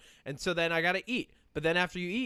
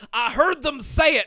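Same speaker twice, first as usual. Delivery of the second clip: high, loud Southern exclamation; hammed-up Southern conspiracy believer; deep Southern accent; 13 semitones higher, 10 dB louder than usual. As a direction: crow it in a mock Southern accent. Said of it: "when a bigoted person".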